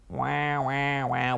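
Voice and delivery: monotone drawn-out wah noises; droning wah-wah. Retold or imitated. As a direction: mimic a parent droning on. Mimics parents' droning talk.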